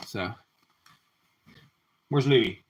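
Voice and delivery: usual speaking voice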